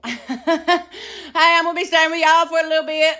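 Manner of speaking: high, loud sing-out hello; hammed-up greeting. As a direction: call it out high to new cellmates. Acts out chirpy greeting to cellmates.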